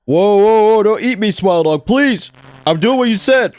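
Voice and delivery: high, frantic mock pleading; mock panic. Viewer begs Smile Dog not to eat them, mock panic. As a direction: beg for mercy in hammed-up alarm.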